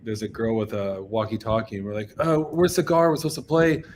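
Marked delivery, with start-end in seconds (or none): 2.2-3.8 higher, louder retold question; retold anxious call-out; band at the gate. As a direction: pipe up over the gate noise.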